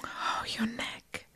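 usual speaking voice

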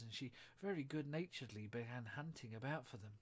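ordinary delivery

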